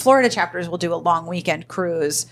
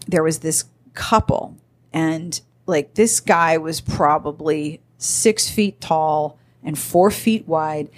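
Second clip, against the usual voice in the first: usual speaking voice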